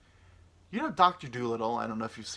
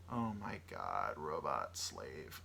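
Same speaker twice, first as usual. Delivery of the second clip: slow, soft and low; low mock awe; 9 semitones lower, 8 dB quieter, much slower than usual. Man imagining owning a robot slave, low slow mock awe. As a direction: draw it out low and hushed. Acts out imagined owner's delight.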